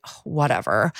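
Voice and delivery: put-on dismissive, bored "whatever" like a mean girl; Clueless-style valley girl eye-roll; bitchy brush-off. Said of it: "amazing, bitchy".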